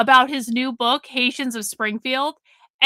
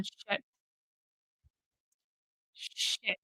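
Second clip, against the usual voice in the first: whispered swear; 4 semitones lower, 18 dB quieter than usual. Real, not acted. Muttering at computer feedback.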